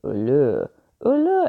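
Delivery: warble into the phone like the puppet; imitating her phone call. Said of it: "you would just hear her go".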